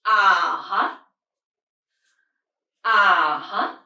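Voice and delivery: UH-HUH dipping low, then rising; third tone. Shown as a demonstration. Teacher shows English-style third tone, dipping UH-HUH. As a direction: slide UH-HUH down first, then up. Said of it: "go down first and".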